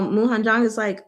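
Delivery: normal talking voice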